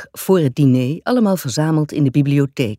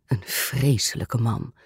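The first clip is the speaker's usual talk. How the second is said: low-key, softer mutter of dislike; muttered aversion; 3 semitones lower, 5 dB quieter than usual. A narrator's reading. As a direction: mutter it under your breath, in character. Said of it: "mompelde gravin Voronskaya". (zacht gemompelde afkeer)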